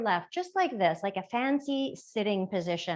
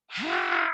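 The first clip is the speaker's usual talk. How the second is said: deep growling monster ha; monster exhale. Shown as a demonstration. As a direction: breathe out like a monster. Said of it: "like a monster sound".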